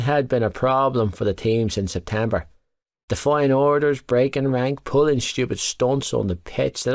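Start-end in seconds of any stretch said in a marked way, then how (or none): none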